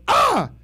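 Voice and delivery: a quick scared squeak; startled yelp. Retold or imitated. Retelling the yelp that hurt his wife, a high startled yelp. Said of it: "me going".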